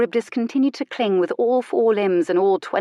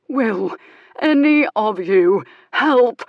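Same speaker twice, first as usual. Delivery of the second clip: exasperated plea; 4 semitones higher, 6 dB louder, slower than usual. Acted. Jules in pain pleading.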